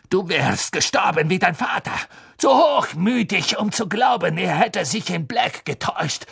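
high, strained taunt easing down; flaring rage. A narrator's reading. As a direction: let the fury burst out, then pull back. Snape's rage flares up.

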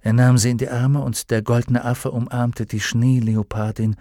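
ordinary delivery, nothing marked

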